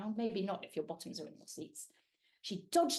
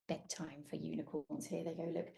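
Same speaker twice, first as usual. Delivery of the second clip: quiet, low lullaby tone; soft bedtime voice; 5 semitones lower, 7 dB quieter than usual. Acted. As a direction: hush them toward sleep. Bedtime hush.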